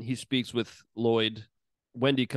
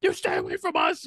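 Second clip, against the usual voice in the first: frantic Wendy. Retold or imitated. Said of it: "And then she's like".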